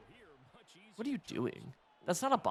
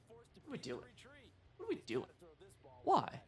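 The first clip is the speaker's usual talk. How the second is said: exasperated high whine; 11 semitones higher, 6 dB quieter than usual. Real, not acted. Exasperated at the play.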